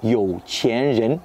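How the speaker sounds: you qian ren said slowly in Mandarin with its tones; Mandarin term. Shown as a demonstration. Teacher giving French viewers the Chinese term, slow Mandarin. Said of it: "des personnes riches".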